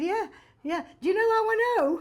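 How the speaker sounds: high-pitched, over-eager showing off; awkwardly trying to be funny. Retold or imitated. Nervous young actress sets up joke for Olivier, awkwardly jokey. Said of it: "trying to be funny".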